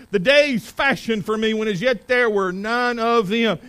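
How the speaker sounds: loud, high, forceful scripture reading; preaching scripture aloud. Acted. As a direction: proclaim the verses to the congregation. Reads Psalm at preaching force.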